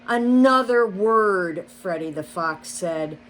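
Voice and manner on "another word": loud, slow menace; bare your fangs on every word; threatening snarl. A narrator's reading. Fox threatening the rabbit, slow and loud.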